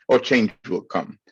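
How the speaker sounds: ordinary delivery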